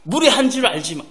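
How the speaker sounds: loud, slow, hammed-up palace formality; a child addressing the king and queen in grand old court style; pompous mock courtier pleading to royal parents; mock royal court speech. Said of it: "뭐 이렇게 안 하잖아요".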